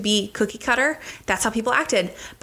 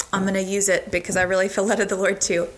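ordinary talk, nothing marked

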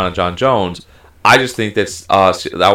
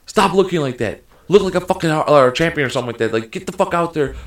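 lightly voiced cornerman, pitched up; mock scolding from the corner, higher than usual; imitating a cornerman; 7 semitones higher than usual. Retelling a cornerman berating his losing fighter, mildly imitated scolding. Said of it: "his corner was like".

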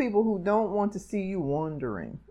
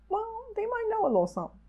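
condescending; 13 semitones higher, 5 dB quieter, much faster than usual. Retold or imitated. Mock condescending concession.

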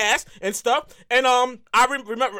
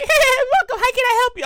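much higher cheerful cashier voice; cashier girl; 11 semitones higher than usual. Retold or imitated. Popeyes cashier greeting a regular, high and sweet. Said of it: "She was like".

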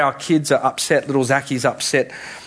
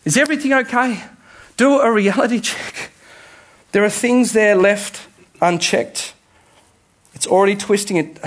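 urgent Jesus voice; 6 semitones higher than usual. Acted. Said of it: "he says".

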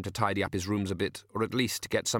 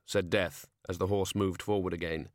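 plain reading voice

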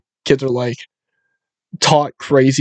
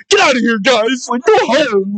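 high, whimpering plea to leave; high tearful send-off, played for laughs; mock near-crying; 8 semitones higher than usual. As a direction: imitate a man on the verge of tears. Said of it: "kind of crying".

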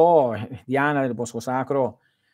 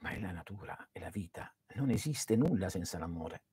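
whisper; 6 semitones lower, 13 dB quieter than usual. Real, not acted. Sussurra sull'amore.

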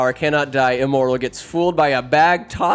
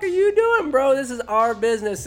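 angry Frodo; 13 semitones higher, 3 dB louder than usual. Retold or imitated. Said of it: "Frodo's like".

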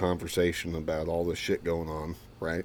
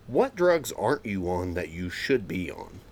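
usual speaking voice

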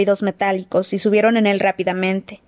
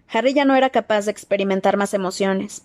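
plain reading voice

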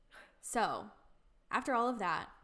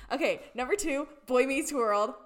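louder, higher, gushing with a laugh; excited gush; 4 semitones higher, 10 dB louder than usual. Real, not acted. Friend gushing to her co-host, excited and laughing. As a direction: burst out loving the show. Gushing about favorite show.

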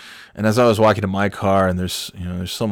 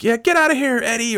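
high-pitched shooing shout; mock yell. Shop owner chasing off a former employee, mock yell. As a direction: imitate yelling someone away.

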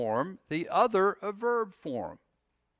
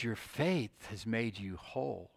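much softer, gentle reassurance; tender Jesus; 7 semitones lower, 12 dB quieter than usual. Retold or imitated. Jesus comforting the frightened woman, softly tender. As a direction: speak it softly, like a blessing to her.

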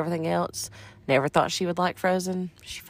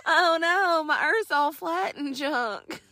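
silly high voice; 11 semitones higher, much slower than usual. Pretend. Mocking flat earthers.

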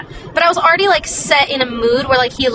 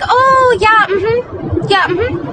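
fake polite agreement; 8 semitones higher, much slower than usual. Faking she understood him.

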